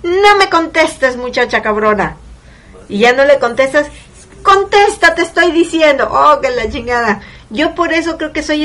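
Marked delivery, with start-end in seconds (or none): 0.0-2.2 scolding mom. Retold or imitated. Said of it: "Te están regañando". 4.4-6.1 high, loud angry demand; scolding mom. Retold or imitated. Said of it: "Te están regañando".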